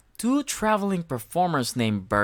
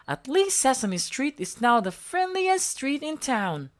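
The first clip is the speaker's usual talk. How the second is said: Smudge voice; 10 semitones higher than usual. A narrator's reading. Smudge giving the ball back, high and shy. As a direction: apologise shyly. Becky continues.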